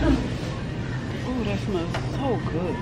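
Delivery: soft, breathy pleasure at a scent; savoring a smell. Real, not acted. Sniffing a candle in store.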